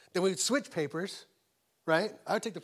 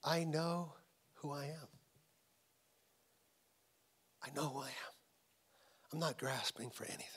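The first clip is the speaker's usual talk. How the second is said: soft, settled voice of Jesus; quiet self-assurance; 6 dB quieter than usual. Acted. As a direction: slip down into a hushed, unhurried certainty. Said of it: "he's like".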